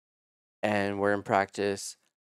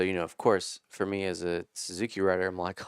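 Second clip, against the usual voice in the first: ordinary delivery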